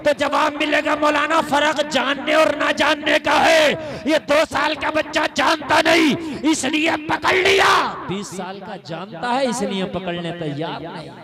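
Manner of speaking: loud, ringing sermon delivery; fiery preacher's call; preaching at full force. Acted. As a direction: thunder it to the gathering.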